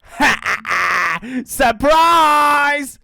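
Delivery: loud, gloating ha-ha with stretched shout; villain's laugh. A narrator's reading. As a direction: laugh it out triumphantly at the trapped joeys. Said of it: "laughed the dingo".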